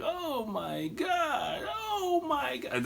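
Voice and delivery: high, drawn-out mock wail; mock disbelief. Himself behind the camera watching chaos, mock disbelief. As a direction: imitate yourself helplessly freaking out, slowly. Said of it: "you can just hear me like".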